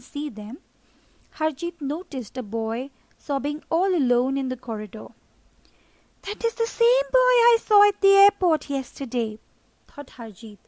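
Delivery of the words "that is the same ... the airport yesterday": put-on higher-pitched, louder boy's voice, caught off guard; mild surprise in a boy's voice; surprised boy. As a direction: blurt it out in surprise, like a young boy. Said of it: "barely able to contain his surprise".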